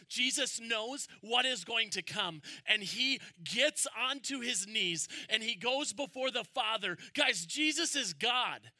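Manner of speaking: fervent pulpit voice; full-force preaching. Acted. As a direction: drive it home to the congregation. Preaching builds over the Garden.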